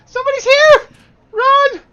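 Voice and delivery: put-on very high, loud panicked kid scream; child's scream. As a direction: scream the alarm like a frightened child. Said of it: "you hear 'em scream, say".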